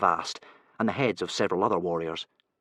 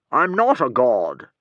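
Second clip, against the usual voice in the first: hero voice; 4 semitones higher, 8 dB louder, slower than usual. A narrator's reading. Hero's voice.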